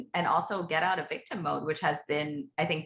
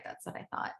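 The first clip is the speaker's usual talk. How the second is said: whispered aside; 10 dB quieter than usual. Real, not acted. Aside to herself.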